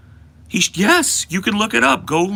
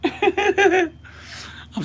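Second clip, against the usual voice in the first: slow, laughing through the words; 4 dB quieter than usual. Real, not acted. Streamer cracking up at his own rant, laughing. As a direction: break up laughing mid-line. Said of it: "laughing at himself".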